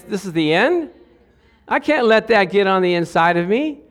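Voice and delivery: normal talking voice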